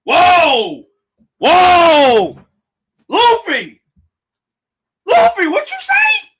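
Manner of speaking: very high, loud whoa of disbelief; shocked shouting. Real, not acted. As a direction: shout in real shock at the screen. Shocked reaction to anime scene.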